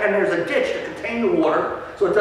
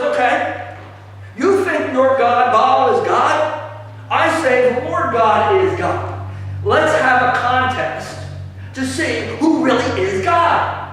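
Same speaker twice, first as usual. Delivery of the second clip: slow, higher, louder bold prophet's dare; Elijah's challenge; 4 semitones higher, 3 dB louder, slower than usual. Acted. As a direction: throw down the gauntlet, slow and proud.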